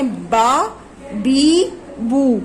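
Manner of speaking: با with mouth wide open, بی with teeth nearly closed, بو rounded; vowel contrast demo. Shown as a demonstration. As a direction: say با، بی، بو each vowel clearly apart. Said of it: "دھیان رکھیے گا کس طرح کی آوازیں".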